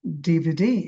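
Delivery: DVD with the last ডি drawn out, rising; stress on last letter. Shown as a demonstration. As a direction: push the final ডি of DVD. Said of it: "সবগুলো একই অবস্থা".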